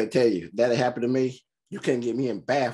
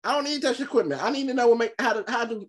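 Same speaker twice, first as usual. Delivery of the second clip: higher-pitched, flustered arguing back; exasperated protest; 8 semitones higher than usual. Pretend. Film viewer talks back about running the boat, exasperated. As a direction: insist on it, stumbling then slowing down.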